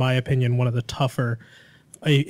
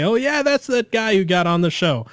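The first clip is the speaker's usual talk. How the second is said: higher-pitched imagined fan; imitating onlookers; 6 semitones higher than usual. Chat members pointing out a listener, mock recognition. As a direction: pipe up as someone spotting a celebrity. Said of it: "everyone can kinda point and say".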